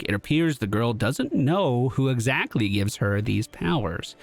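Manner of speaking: normal talking voice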